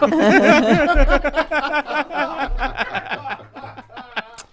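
loud high burst of laughing; hearty laughter. Real, not acted. Laughing hard after telling the mom story. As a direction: crack up at his own story. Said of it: "laughs after his story".

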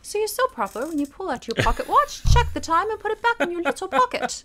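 prim, proper gentleman, pitched high; playing a fussy upper-class toff; posh British voice. Mocking proper pocket-watch gentleman.

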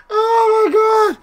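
high, squeaky exclamation after laughing; weak from laughing. Real, not acted. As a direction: gasp it out, spent from laughter.

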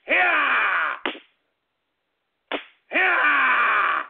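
drawn-out falling "yeah" with each lash; pretend whipping yell. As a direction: yell as you swing the belt. Pretends to whip with belt.

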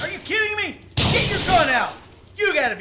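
real enraged outburst; furious yelling. Real, not acted. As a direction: scream at the screen in disbelief. Furious at the game's enemies.

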